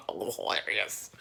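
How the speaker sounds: flat, slow, breathy "hilarious"; deadpan dismissal; dry sarcasm after mocking them; sarcastic. Real, not acted. Sarcastic aside.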